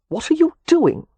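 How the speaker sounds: high, startled demand; incredulous demand. A narrator's reading. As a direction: demand in disbelief. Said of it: "Leo demanded".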